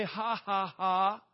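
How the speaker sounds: loud, deliberate "ha, ha, ha"; demonstrated laugh. Shown as a demonstration. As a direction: lead the crowd in a forced laugh. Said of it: "Somebody say".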